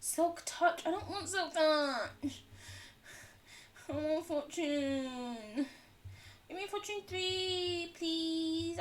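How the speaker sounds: high, drawn-out pleading; whiny mock begging. Streamer bribing the game for fortune, pleading whine. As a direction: wheedle like a child, stretching the words.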